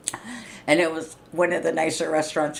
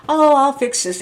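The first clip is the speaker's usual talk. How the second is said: mock helpful coworker; 9 semitones higher, 10 dB louder than usual. Mocking coworker's fake helpfulness.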